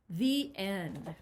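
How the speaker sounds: ordinary delivery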